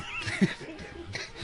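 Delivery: quiet breathy chuckle; a short soft laugh under the breath. Real, not acted. Laughs at the bee joke.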